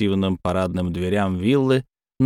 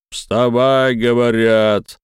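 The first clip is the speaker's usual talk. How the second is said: loud, slow barked order; booming thunder voice; 2 semitones higher, 4 dB louder, much slower than usual. Acted. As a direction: roar it to rouse a drunk. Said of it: "прогремел голос Кимо Акубары".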